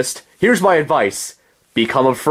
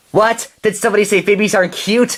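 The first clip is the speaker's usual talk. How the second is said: outraged gasp; 5 semitones higher than usual. Acted. Puppet outraged at babies insult.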